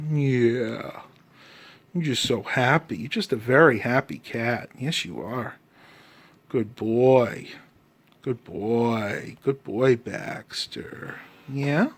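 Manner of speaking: quiet, tender cooing; soft pet talk. Real, not acted. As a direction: murmur praise into the cat's ears. Softly cooing at the purring cat.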